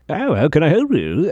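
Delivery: put-on high, swooping old gentleman voice; quavery-pitched old man impression; older gentleman. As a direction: put on an elderly shopkeeper greeting a customer. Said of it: "then an older gentleman comes out".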